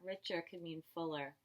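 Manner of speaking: usual speaking voice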